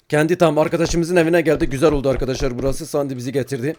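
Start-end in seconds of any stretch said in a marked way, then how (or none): none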